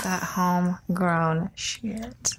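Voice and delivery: slow sassy stage whisper. Acted. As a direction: breathe it out slow and sly. Hyping weed, then apologizes vulgar.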